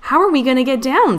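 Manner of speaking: fast, high-pitched alarm; worried cry. A narrator's reading. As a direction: cry out in a hurry, mildly panicked. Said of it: "Scout cried".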